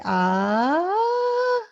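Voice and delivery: long AH gliding steeply upward; rising pitch. Shown as a demonstration. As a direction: slide AH up high and hold it. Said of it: "it's going up".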